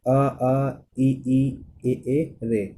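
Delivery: अ, आ, ई, ई, ए, ए said one by one, held; vowel demo series. Shown as a demonstration. Teacher chanting vowels for students to copy. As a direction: run through the unrounded vowels slowly. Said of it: "यदि आप बोल के देखें".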